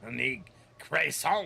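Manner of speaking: hammed-up French order; mock French. Shown as a demonstration. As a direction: savour "croissant" slowly in a fake French accent. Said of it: "making me pronounce things".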